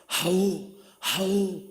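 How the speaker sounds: loud barking battle chant; warriors' shout. Acted. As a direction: bark it like soldiers answering their king. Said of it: "empiezan a gritar". (grito de guerreros a coro, fuerte)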